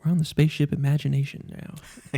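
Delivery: a soaring cosmic documentary host; Neil deGrasse Tyson voice. Pretend. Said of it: "Sounds like, uh, Neil deGrasse Tyson's".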